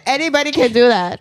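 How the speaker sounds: ordinary talk, nothing marked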